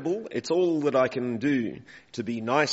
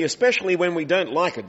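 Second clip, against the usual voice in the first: ordinary delivery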